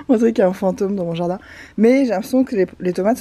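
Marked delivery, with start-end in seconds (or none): 0.1-1.4 giggling through the words; laughing. Real, not acted. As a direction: laugh while saying it. Laughing at her netting.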